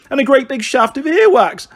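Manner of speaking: high, loud playground holler; jeering schoolchild's yell; hollering child. Acted. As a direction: call it out for all to hear. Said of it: "hollered another".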